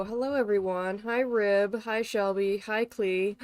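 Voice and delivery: ordinary talk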